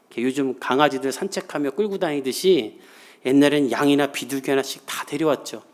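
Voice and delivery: normal talking voice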